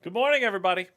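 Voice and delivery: big loud hello; loud greeting. Acted. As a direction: call out good morning. Stream greeting call out.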